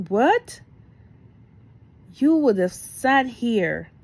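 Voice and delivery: high, loud, slow scold; incredulous scolding. Retold or imitated. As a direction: let him have it in disbelief. Scolding husband in disbelief.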